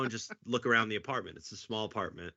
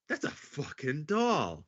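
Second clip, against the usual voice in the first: slow deadpan realization; 4 semitones higher, slower than usual. Retold or imitated. Realizing it's a sex doll.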